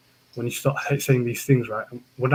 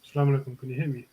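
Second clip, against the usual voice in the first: faint, far from the mic; almost too quiet to hear; barely audible; 8 dB quieter, much faster than usual. Real, not acted. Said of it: "Wow, very quiet".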